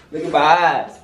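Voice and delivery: ordinary talk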